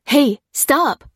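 loud, high shout to halt; urgent cry. A narrator's reading. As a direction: call out sharply to stop someone. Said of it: "cried a voice".

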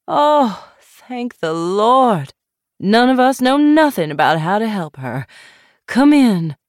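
put-on a capable country neighbor in a thick Southern accent; upcountry Southern accent. A narrator's reading. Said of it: "She said in an upcountry southern accent".

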